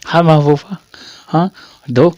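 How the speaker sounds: ordinary delivery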